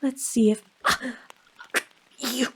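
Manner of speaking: ordinary talk, nothing marked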